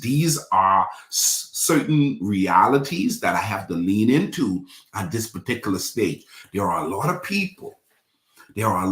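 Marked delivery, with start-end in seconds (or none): none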